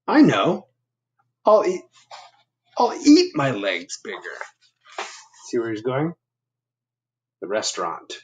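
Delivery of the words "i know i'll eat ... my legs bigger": high, eager idea voice; bursting with a new plan; excited Bob. Acted. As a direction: Bob brightly announcing his plan.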